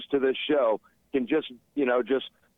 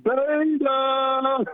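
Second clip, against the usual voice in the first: excited shout; 12 semitones higher than usual. Real, not acted. Caller's excited greeting shout.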